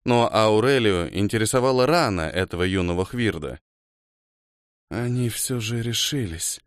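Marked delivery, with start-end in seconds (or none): 4.9-6.6 slow, soft, hoarse whisper. A narrator's reading. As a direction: rasp it out quietly, slowly. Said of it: "хрипло прошептала Кошмар".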